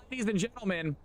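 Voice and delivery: high showman call; hype announcer. Acted. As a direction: call out to the crowd. Restarting hype intro.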